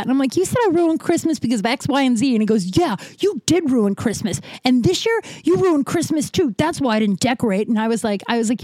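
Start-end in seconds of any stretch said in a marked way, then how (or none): 0.4-2.3 high-pitched, heated accusation; losing it. Retold or imitated. Retelling the row with her father, heated accusing. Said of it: "I lost it". 2.8-7.7 high-pitched, emphatic mock scolding; accusing retort. Imitating father blaming her for Christmas, accusing.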